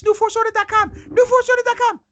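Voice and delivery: high, loud, hyped-up plug; hype announcer. Acted. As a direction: shout the website like an announcer. Hyping the website, pitched way up.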